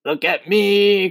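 high, drawn-out boastful cry; exultant cry. A narrator's reading. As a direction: crow it out for everyone to see. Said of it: "cried the centipede".

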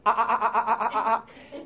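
loud, high machine-gun laugh; rapid-fire staccato "ah" cackle, mimicked; imitated weird laugh. Imitating a college classmate's memorable laugh, weird machine-gun laugh. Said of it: "had this very weird laugh".

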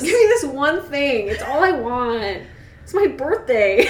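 slow, stretched-out whiny begging; mock pleading. Reader as Mike begging Edward, hammed-up pleading. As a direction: draw out the plea, playfully pathetic.

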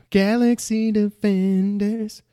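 high, slow announcer tone; playful pitch of a title, high and slow; mock trailer voice.

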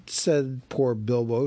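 ordinary delivery

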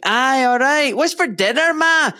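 high-pitched, thick Glaswegian imitation; working-class Glasgow accent. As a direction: call to ma in broad childhood Glaswegian. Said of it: "that's how I used to speak".

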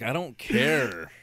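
drawn-out, slow, deadpan. Retold or imitated. Clerk cutting off a chatty customer, very slow. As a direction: drag it out, bored stiff. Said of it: "I was like".

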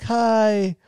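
put-on high, bright bye-bye to a baby; dad singing bye-bye to his baby girl; sing-song baby talk. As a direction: coo it to your daughter like a dad. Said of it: "And I'll be like".

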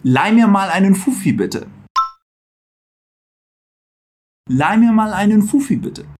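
polite German model; model for repeating. Shown as a demonstration. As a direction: say it for the class to echo. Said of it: "repete aí comigo".

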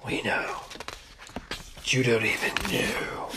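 low mysterious whisper, teasing; spooky whisper. Pretend. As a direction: hush it like a secret. Spooky teasing aside continues.

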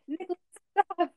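high, urgent acted plea; begging. A narrator's reading. Sonic begging Cage to stop, urgent plea. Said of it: "Sonic begged once again".

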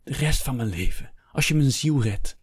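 high, swooping desperate plea; urgent pleading, pitch leaping up; begging. A narrator's reading. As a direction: implore him to save your soul. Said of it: "smeekte Hector".

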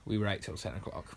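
put-on low, understated boy's brag; Danny's version. Danny giving his account of the night, low and offhand. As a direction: say it low and offhand, lightly acted. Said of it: "Like, Danny's like".

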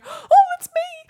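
mock someone taking it personally with a wail; high, loud fake crying. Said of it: "you go".

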